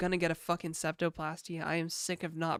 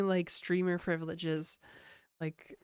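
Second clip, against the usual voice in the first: usual speaking voice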